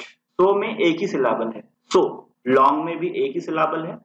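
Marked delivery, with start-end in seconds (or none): none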